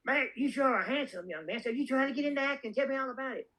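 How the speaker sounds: put-on high-pitched little old lady voice; Renee Valente. Producer sizing up a young would-be actor, high old-lady impression. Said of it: "she sounds like this when she talks".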